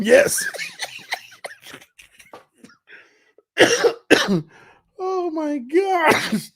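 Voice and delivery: high laughing gasp. Real, not acted. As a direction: laugh yourself into a cough. Still laughing hard.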